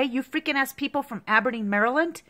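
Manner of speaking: ordinary talk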